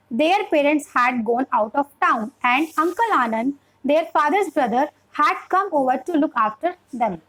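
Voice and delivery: slow, measured phrases with silent counts at full stops; flowing model reading. Shown as a demonstration. Teacher showing how to pause at full stops, slow model reading. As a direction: read slowly and clearly, pausing between phrases. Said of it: "मन में one two counting लेना है".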